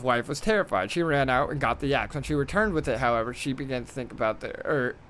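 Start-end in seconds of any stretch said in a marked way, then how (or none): none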